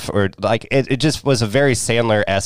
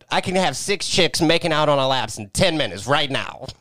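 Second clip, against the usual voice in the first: higher, put-on character voice; quoted movie character; 6 semitones higher than usual. Host quoting strip club line from movie, higher put-on voice. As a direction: deliver it as a mimicked movie boast.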